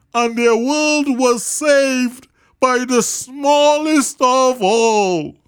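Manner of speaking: big high character voice, calling loudly; rally them with a loud, high earnest call; Horton calling out. A narrator's reading. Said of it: "the elephant smiled".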